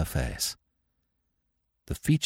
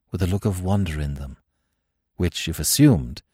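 plain reading voice

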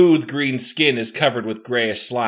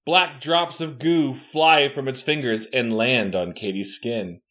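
usual speaking voice

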